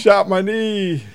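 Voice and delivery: slow, high hammed-up complaint; mock pained whine. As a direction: moan it like a wounded fool. Acting out the shot man.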